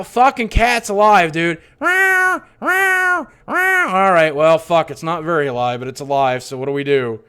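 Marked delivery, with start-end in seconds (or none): 1.8-3.9 high, thin kitten meows, much higher and louder; cat meowing. Retold or imitated. Narrator imitating the buried cat's meows, high and loud. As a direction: mew like a weak dying kitten. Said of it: "I hear".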